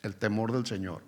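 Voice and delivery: hushed, low repeat of "El temor del Señor"; quiet repeat. Shown as a demonstration. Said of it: "es, escuchen".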